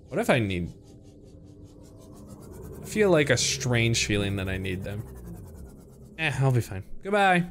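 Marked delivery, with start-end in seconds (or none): none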